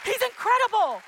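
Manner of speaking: high, loud climactic praise shouts; preacher at full force, shouting God's praise; full-force preaching. Acted. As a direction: lift the whole church with every line.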